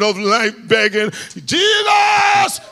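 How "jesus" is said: high shouted cry of a beggar; desperate cry. A narrator's reading. Blind beggar calling to Jesus by the road, high shout. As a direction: call out with everything. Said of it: "caught on fire".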